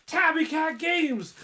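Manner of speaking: re-enacted announcer yell, high and drawn out; imitated excited read. Streamer showing what sets off Peach, imitated excited yell. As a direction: imitate your own big shout-out. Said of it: "when she hears my voice go".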